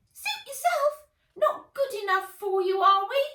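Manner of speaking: very high, loud squeaky huff; sulky guinea lion. A narrator's reading. Guinea lion taking offence at the boy, high squeaky huff. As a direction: sniff it like an offended pet.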